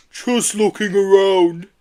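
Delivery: slow, far-off creature voice; Yeti voice. A narrator's reading. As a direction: drawl it out like a big gentle Yeti.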